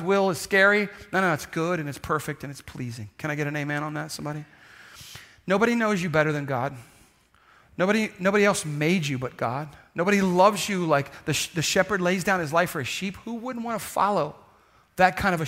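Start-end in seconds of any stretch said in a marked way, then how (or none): none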